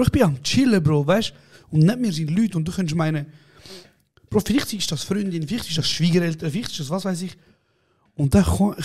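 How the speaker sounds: usual speaking voice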